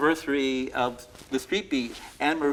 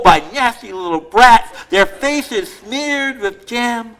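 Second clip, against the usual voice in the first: higher, louder, exasperated complaint; grumpy Santa lookalike; 7 semitones higher, 13 dB louder, slower than usual. Acted. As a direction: rant like a fed-up bearded man. Continues grumpy Santa poem.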